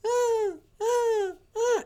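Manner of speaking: high, thin mimicked whimper; squeaky imitation of a whining dog; imitated dog whine. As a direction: whimper like a puppy wanting out. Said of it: "He starts whining".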